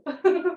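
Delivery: quick light laugh; short laugh. Real, not acted. After admitting she sounds excited, short light laugh. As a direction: laugh off her own excitement. Laugh closing her answer.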